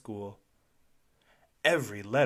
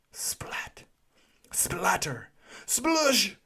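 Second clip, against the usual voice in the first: splashy sound words; 8 semitones higher, much slower than usual. A narrator's reading. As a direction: build the mess from a whisper to a splash.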